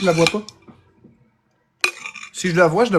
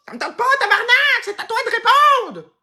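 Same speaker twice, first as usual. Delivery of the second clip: high, fast squabbling shriek; acted angry yelling; 3 dB louder than usual. Refusing to answer the ringing phone, high angry yell.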